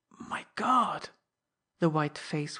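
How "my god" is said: a gasped, voiceless oath; fearful whisper. Acted. Old minister whispering in fear at the Gray Seal's name. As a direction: breathe it out in dread.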